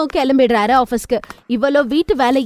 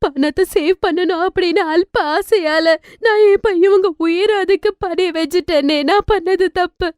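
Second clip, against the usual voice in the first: remorseful; 4 semitones higher than usual. Acted. Mother admits her stinginess at hospital, remorseful. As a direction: own the mistake with regret.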